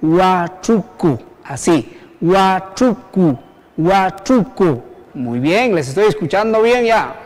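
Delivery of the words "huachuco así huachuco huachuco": "huachuco" very slow, as "hua-chu-cu" with gaps; syllable by syllable. Shown as a demonstration. Teacher leading all to pronounce together, slow syllables. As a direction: lead a slow chant-along of "huachuco", curled CH. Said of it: "vamos a pronunciar juntos".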